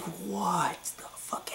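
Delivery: slow whispered disbelief; stunned whisper. Retold or imitated. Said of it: "I'm just like".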